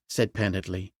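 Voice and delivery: ordinary delivery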